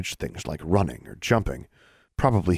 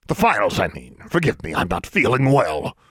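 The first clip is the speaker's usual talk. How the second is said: posing as a man. A narrator's reading. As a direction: excuse yourself, keeping up the disguise. Disguised Alexandra excuses herself as Mister Brown.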